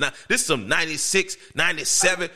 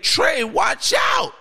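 high, drawn-out warning yell; imitated alarm call, pitched way up; shouted warning; 14 semitones higher, 3 dB louder, much slower than usual. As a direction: call out a warning, stretching the words. Retold warning called out to him.

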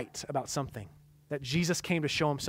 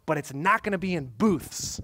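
ordinary talk, nothing marked

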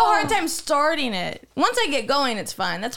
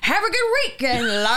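attempted Irish accent. Shown as a demonstration. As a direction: botch the Irish with an R in 'lards', laughing. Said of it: "Why are you saying lards?".

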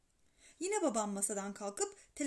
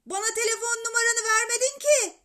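high, loud protest; boy loudly protesting down the line; shouting back; 10 semitones higher, 10 dB louder than usual. A narrator's reading. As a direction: yell it into the phone.

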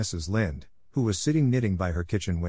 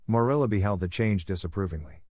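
ordinary delivery, nothing marked

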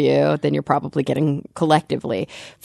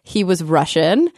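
ordinary talk

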